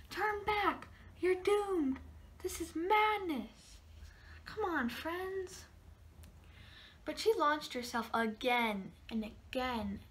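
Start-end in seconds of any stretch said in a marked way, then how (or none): 0.1-5.6 high, urgent doomsaying; dramatic warning cries. Retold or imitated. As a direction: cry out to stop her. Crowd shouting warnings.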